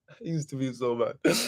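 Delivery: amused, laughing confession. Real, not acted. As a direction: trail into laughter as you admit it. Laughing at old self.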